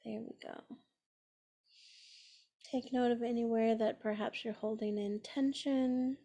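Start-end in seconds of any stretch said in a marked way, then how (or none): 0.0-0.8 very soft, almost under the breath; a barely voiced settling aside; hushed reassurance. Real, not acted. Guide letting listeners get cozy, hushed reassurance.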